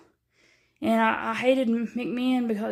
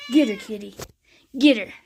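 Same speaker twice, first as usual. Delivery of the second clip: egging on cat; 6 dB louder than usual. Real, not acted.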